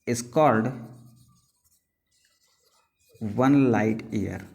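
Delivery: very slow dictation, phrase by phrase; slow classroom dictation; dictation pace. Shown as a demonstration. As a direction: pause after each chunk so they can write. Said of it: "teacher dictating definition".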